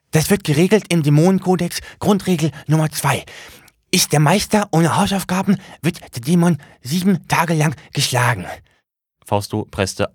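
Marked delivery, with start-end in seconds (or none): 0.1-8.5 high, louder demon reciting a rule; high excited little demon voice; agitated demon. Acted. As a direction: rattle off the rule in alarm. Demon recites his code.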